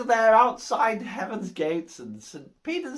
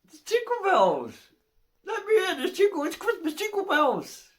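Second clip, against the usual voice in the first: higher, hammed-up insistence; pleading joke character; 6 semitones higher than usual. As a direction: act out a hopeful chancer pleading his case. Acted man pleads with his keys.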